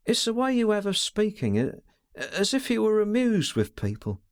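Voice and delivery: a lightly voiced girl, stammering with feeling; young woman's voice. A narrator's reading. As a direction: voice the young woman, anxious and eager. Her voice again.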